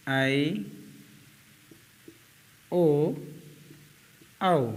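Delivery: each vowel drawn out on its own; long vowels. Shown as a demonstration. Teacher listing long vowels for the class, drawn out. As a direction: say each long vowel separately, held, with pauses. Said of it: "उनको हम दीर्घ स्वर कहते हैं".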